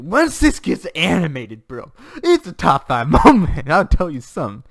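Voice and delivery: drawn-out, booming fan excitement; loud hype. Real, not acted. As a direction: proclaim it, bursting with enthusiasm. Hyped about animated adaptation.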